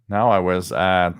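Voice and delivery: ordinary talk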